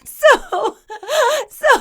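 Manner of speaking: helpless high-pitched laughter on the word; laughing too hard to go on; cracking up. Real, not acted. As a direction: try to speak through giggles. Cracking up while telling story.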